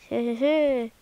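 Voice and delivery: mock villain cackle; a sly little evil laugh; hammed-up sinister chuckle. Plotting revenge, sinister laugh.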